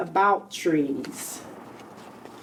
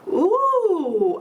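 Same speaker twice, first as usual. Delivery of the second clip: put-on higher, delighted child's ooh; awed ooh; 6 semitones higher than usual. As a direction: gasp of wonder at the tree. Child sees decorated tree.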